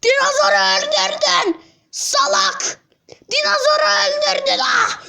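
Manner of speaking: fast high shouting on the repeat; high accusing cry. Acted. Doll blames killer.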